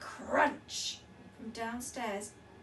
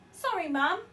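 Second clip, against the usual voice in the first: loud hurried apology; yelled; 5 semitones higher, 10 dB louder than usual. A narrator's reading. Daughter grabbing the baby off mum, yelled. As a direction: call it out while rushing in. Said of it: "yelled Laura".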